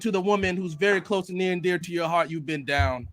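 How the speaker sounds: solemn preacher addressing the congregation; hammed-up funeral sermon voice; eulogy for a fan let down by Tokyo Ghoul; mock preacher eulogy.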